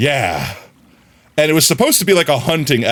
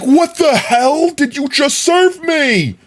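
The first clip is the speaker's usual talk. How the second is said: high, drawn-out cry of disbelief; mock outrage; 14 semitones higher, slower than usual. Streamer reacting to what he was served, mock outrage. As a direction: wail it in exaggerated indignation.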